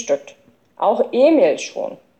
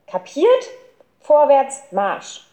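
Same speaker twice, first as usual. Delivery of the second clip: high questioning lift, then orders; brisk command; 7 semitones higher, slower than usual. Acted. Boy ordering his gang out, commanding. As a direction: send them off like a little commander.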